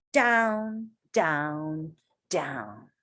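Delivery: sinking voice. Acted. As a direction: let the voice dive to the bottom. Read-aloud dive, voice sinking.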